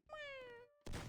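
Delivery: high, soft, pitiful kitten meow; tiny whimpering cat cry; sad meow. Retold or imitated. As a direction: mew like a sick little cat. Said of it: "it's a sad meow".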